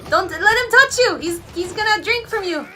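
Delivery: high, urgent cry to a fighter; urgent warning. Real, not acted. Viewer warning the fighter on screen, urgent. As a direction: warn him off in a hurry.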